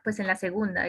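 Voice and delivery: ordinary talk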